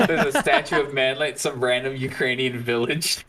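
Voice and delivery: laughing while talking. Real, not acted. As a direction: chuckle through the line. Joking about a Manly statue.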